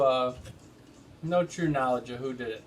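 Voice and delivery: ordinary delivery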